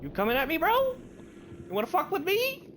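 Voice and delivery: high, loud taunt; mock-tough high yell; taunting challenge. Real, not acted. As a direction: squeal it out as a challenge. Taunting an attacking creature.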